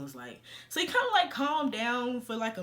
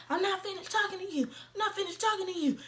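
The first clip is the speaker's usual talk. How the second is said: higher, fast mock rant at her; imitating an angry ex; 9 semitones higher, faster than usual. Ex chasing her car, imitated as a fast, high rant. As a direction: rattle it off like a pushy ex. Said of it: "really getting at it".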